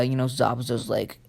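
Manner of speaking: ordinary delivery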